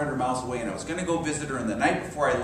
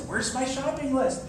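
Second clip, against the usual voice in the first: higher mock frantic search; frantic wife; 10 semitones higher than usual. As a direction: play the wife hunting for her list.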